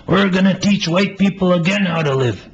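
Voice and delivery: high, loud, eager voice. Acted. Playwright voices the eager friend reassuring Melvin, high and loud. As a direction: answer like it's obvious, bursting with certainty.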